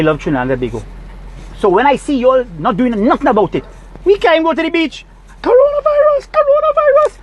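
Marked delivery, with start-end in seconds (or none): 4.0-7.2 very high, sing-song mock cry of the word. Sneering at official panic, in a high mocking voice. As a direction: parody the panic in a squeaky voice.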